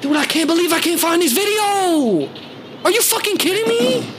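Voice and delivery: high-pitched exasperated whine at the missing video; frustrated outburst. Real, not acted. Host can't find the video, frustrated high-pitched whine. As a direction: voice jumps way up, fed up.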